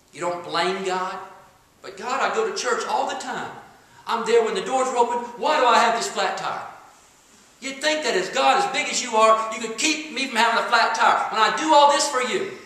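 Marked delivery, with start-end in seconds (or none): 1.8-12.6 louder, higher aggrieved whine; whiny complaining. Retold or imitated. A churchgoer complaining to God about a flat tire, aggrieved whine. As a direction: complain to God, feeling wronged.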